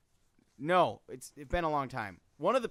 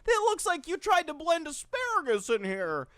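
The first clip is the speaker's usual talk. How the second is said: mom voice; 14 semitones higher, 6 dB louder than usual. Retold or imitated. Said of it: "the mom comes home and she's like".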